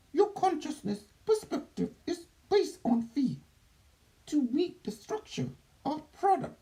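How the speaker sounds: high alien reptilian voice; otherworldly being, high and strange; Doctor Nucky. Acted. Channeled being answers questions.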